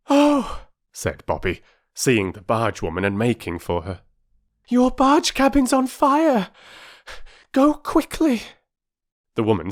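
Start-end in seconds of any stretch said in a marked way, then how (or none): 0.1-0.7 put-on high, loud girl's voice; girl's urgent cry. A narrator's reading. Child bursting in, urgent. 4.7-8.4 put-on high, loud girl's voice; girl telling the barge woman her cabin is burning; urgent girl's warning. A narrator's reading.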